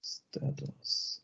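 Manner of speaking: barely audible, to oneself; murmuring while typing. Real, not acted. Presenter filling in table data during a demo, murmuring. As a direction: say it under your breath as you type.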